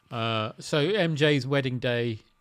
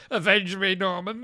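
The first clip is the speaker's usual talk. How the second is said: higher wailing comic plea; Norman Wisdom voice; 6 semitones higher than usual. Acted. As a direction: plead high like a slapstick dad. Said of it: "Norman Wisdom".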